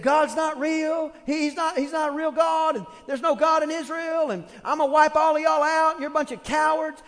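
shouted taunts; Goliath shouting. Retold or imitated. Giant shouting across the valley at Israel. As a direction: bellow defiance across the valley. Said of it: "he said, he said".

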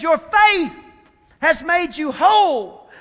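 loud, slowed proclamation; Jesus proclaiming. Acted. Jesus tells the healed woman her faith saved her, loud. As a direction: declare her healed with full force.

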